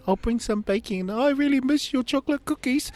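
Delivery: high, loud hammed-up weepy voice; mock tearful wail. Ex-wife offering to bring baking, imitated high wail. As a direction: squeal it out as if laughing through tears. Acts ex-wife's tearful reply.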